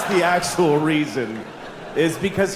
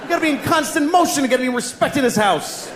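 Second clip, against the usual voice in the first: panicked fish; 9 semitones higher than usual. Acted. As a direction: yell like a fish woken mid-nap. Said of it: "it looks like he goes".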